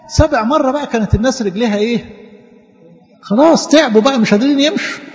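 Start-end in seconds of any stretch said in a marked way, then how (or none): 3.2-5.0 higher-pitched, lightly acted tiredness; mock weariness. Teacher describes worn-out marchers to children, mock weariness. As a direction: lift the pitch in mild mock exhaustion.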